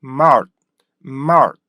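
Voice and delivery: mart punched, opening wide into an r; lips pushed forward. Shown as a demonstration. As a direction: say mart loud, mouth moving forward. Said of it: "앗 하면서 입이 앞으로". (입을 내밀며 세게 mart)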